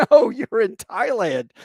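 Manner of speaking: high, loud and slow, spelling it out for a lost pilot; drawn-out know-it-all clerk voice; mock official correction. Clerk tells the stray pilot where he really is, slow and loud.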